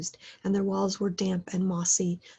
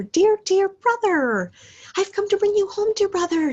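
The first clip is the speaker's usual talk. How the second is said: put-on little girl; 12 semitones higher, 7 dB louder than usual. A narrator's reading. Little Fan comes to fetch her brother home, high excited child voice. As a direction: play an overjoyed little sister.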